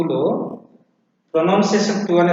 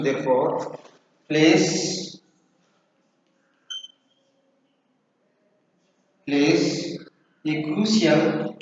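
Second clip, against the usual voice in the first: slow, measured classroom dictation; 5 dB quieter, much slower than usual. Real, not acted. Teacher dictating intonation notes for students to write, slow dictation. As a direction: dictate in short repeated chunks with long pauses.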